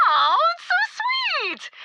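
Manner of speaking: very high, cooing aww; exaggerated, syrupy gush; gushing delight. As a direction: coo over it like a cute baby. Leader gushes over a child's poem.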